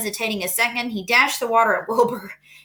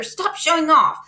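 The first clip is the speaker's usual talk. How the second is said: scolding; 6 semitones higher, 3 dB louder than usual. A narrator's reading. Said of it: "cried his mother".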